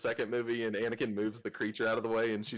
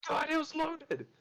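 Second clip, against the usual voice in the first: higher, mock-panicked father; imitating upset dad. Father finding the loaded gun, mock-panicked and higher. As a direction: play the dad in a fright, pitched up. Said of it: "He's like".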